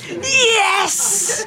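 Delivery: high, elated exclamation; triumphant cry. Real, not acted. Girl exulting after her shout, triumphant yes. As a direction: punch the air with it.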